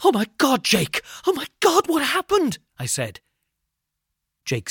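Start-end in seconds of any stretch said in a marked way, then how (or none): 0.0-2.6 shocked panic. Acted. Friend sees Jake bleeding.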